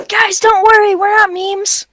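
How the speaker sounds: high, loud mock announcer. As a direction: reassure the viewers like a host. Addressing viewers in a put-on voice.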